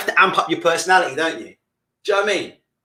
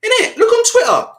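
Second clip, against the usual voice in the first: much higher, louder outburst; worked up; 5 dB louder than usual. Real, not acted. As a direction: fire it at the chat, indignant. Worked-up rant at audience.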